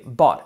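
"bought" with a vowel between A and O; middle vowel. Shown as a demonstration. Teacher models "bought" in the middle, between A and O. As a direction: settle "bought" on an open aw. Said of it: "En medio".

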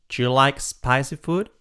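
ordinary talk, nothing marked

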